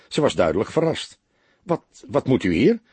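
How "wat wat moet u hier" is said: stammering. A narrator's reading. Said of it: "stamelde ze".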